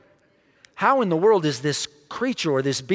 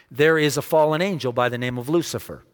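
ordinary talk, nothing marked

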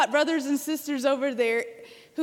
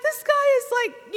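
much higher, amazed gasp; 11 semitones higher, 4 dB louder than usual. Retold or imitated. Recalling her amazement at the singing man, high amazed voice. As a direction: marvel out loud. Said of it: "I was like".

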